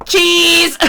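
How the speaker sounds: loud, high, drawn-out word; retold shout. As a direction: imitate someone shouting for the camera. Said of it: "he shouted".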